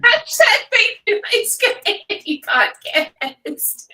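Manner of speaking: high, slow, loud promo voice; hammed-up hashtag plug. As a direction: announce it like a big hashtag. Mock hashtag announcement.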